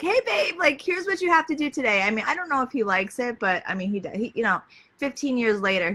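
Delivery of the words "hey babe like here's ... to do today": much higher, bright and sing-song; chirpy wife to husband. Retold or imitated. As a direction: sweetly hand the husband his to-do list. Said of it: "I make lists for my husband".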